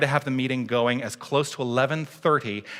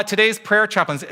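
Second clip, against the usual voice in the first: ordinary talk, nothing marked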